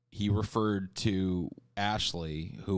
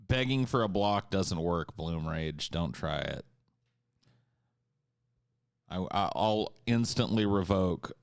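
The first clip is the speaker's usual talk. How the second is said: ordinary delivery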